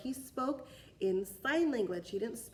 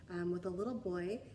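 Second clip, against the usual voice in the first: plain reading voice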